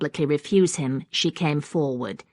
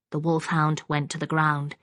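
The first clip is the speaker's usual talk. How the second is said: ordinary delivery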